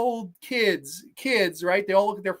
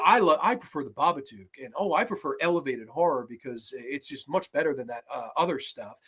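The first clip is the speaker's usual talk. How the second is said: snooty mock; 8 semitones lower, 5 dB quieter than usual. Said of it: "And she's going".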